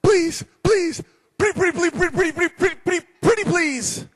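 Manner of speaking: very high, rapid-fire squeaky pleading; desperate begging. Acted. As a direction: beg frantically like a scared debtor. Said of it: "the guy's such a good beggar".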